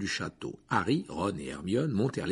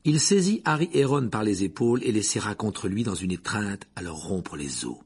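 ordinary delivery, nothing marked

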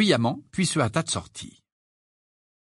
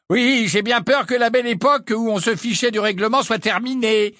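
higher, louder solemn mock regret; mock lament; 12 semitones higher, 5 dB louder than usual. A narrator's reading. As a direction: pretend to mourn the old days.

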